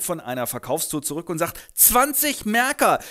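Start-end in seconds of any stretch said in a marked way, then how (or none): 1.7-3.0 freudestrahlend. Acted. Franz prahlt freudestrahlend.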